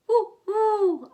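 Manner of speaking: high, loud wind howl. A narrator's reading. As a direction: moan it like the wind in the trees. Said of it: "howled the wind".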